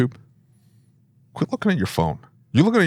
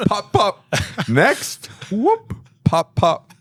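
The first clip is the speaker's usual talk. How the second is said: high, quick sing-song priest; joking priest; 11 semitones higher, much slower than usual. Pretend. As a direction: toss off each one like a speedy priest. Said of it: "if I were a priest, I'd be like".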